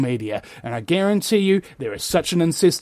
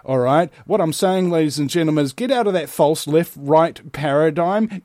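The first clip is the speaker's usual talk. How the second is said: usual speaking voice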